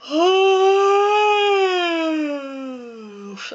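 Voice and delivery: long, loud open yawn; real drawn-out yawn; big yawn. Real, not acted. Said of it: "sorry about the yawning".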